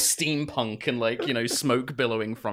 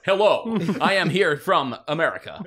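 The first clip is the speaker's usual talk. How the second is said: hammy American dub voice; overacted dubbed American accent; bad movie dubbing; 6 semitones higher, 7 dB louder than usual. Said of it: "with very American".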